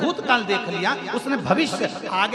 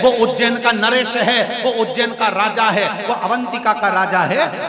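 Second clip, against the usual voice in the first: loud, driving sermon delivery; full-force preaching; 5 dB louder than usual. Acted. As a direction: preach it out to the whole crowd.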